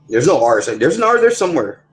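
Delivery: normal talking voice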